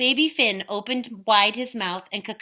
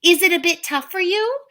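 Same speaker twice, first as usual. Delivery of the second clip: teasing; 10 semitones higher, 7 dB louder than usual. Acted. Una teasing.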